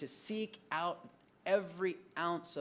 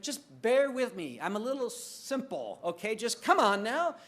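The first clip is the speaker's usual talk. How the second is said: higher, louder coaxing plea; pleading husband; 7 semitones higher, 5 dB louder than usual. Retold or imitated. Preacher as himself begging his wife to say it again, higher and louder. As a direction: coax her to repeat it, a bit higher. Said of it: "And I'm like".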